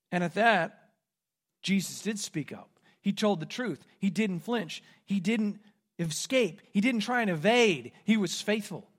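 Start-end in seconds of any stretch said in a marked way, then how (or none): none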